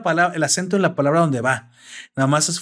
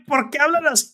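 higher-pitched, puzzled protest; baffled learner; 10 semitones higher than usual. Acted. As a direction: ask it high, as if it makes no sense. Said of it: "Y no te puedes explicar".

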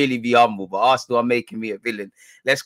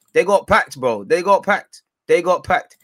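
gloating; 5 semitones higher, 7 dB louder than usual. Real, not acted. Gloating over rival's heavy defeat.

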